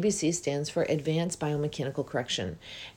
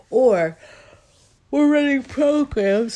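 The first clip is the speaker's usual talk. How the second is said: words stretched high through a big yawn; 9 semitones higher, 9 dB louder, slower than usual. Real, not acted. Coach talking to viewers while yawning mid-sentence. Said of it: "Sorry about the yawning".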